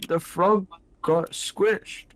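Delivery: slow, loud and high, drawn out; dramatic wail. Real, not acted. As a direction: wail it like a tragedy.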